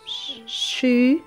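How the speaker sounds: usual speaking voice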